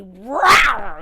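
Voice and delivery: loud barking roar; sudden loud dog bark; dog's bark-growl. Acted. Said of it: "and he".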